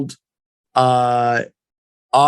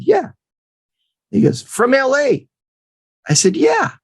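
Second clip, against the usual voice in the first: very high sheepish yeah; squeaky yeah; 10 semitones higher than usual. Retold or imitated. Young agent answering the coach, squeaky sheepish yeah. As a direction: squeak it out nervously.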